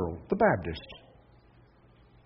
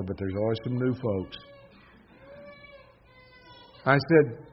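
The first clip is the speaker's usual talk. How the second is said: normal talking voice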